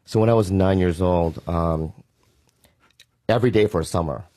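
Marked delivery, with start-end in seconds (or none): none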